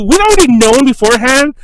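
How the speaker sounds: ordinary delivery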